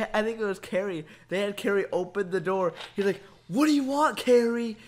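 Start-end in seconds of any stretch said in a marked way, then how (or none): 3.5-4.8 louder and higher, irritated Michael; a half-hearted impression of an angry gamer; mock angry yell. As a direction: snap at Carrie through the door. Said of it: "he was yelling".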